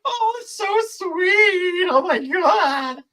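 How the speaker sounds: hammed-up weepy gush; imitating himself tearing up at a soldier homecoming clip; imitating teary gush. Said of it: "Instantly get tears in my eyes".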